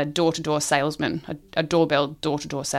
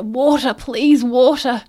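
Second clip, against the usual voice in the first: higher, louder hammed-up begging; mock desperate plea; 7 semitones higher, 6 dB louder, much slower than usual. Lost men crawling into third tent, mock desperate plea. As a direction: plead for water as if crawling in from the desert. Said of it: "they crawl in and they're".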